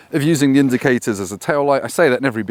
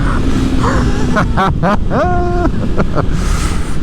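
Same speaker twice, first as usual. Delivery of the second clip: gleeful laugh; 11 semitones higher, 4 dB louder than usual. Real, not acted. Laughing after fun.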